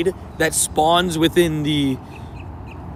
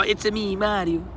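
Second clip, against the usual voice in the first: Mario voice; 4 semitones higher, 6 dB quieter, slower than usual. Retold or imitated. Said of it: "Somebody call".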